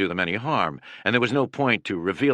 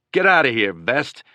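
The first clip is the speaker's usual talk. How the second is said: loud, higher angry yell; 5 semitones higher, 5 dB louder than usual. A narrator's reading. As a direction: bark it at him to back off. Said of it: "somebody else yelled".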